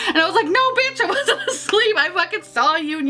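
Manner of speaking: words squeezed out high through laughter; giddy, high, laughing protest; high laughing retelling. Real, not acted. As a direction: laugh while insisting you weren't asleep.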